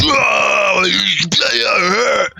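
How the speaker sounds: high, drawn-out pained howl; Guark. Acted. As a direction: wail as if just smacked in the face. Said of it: "Guark says".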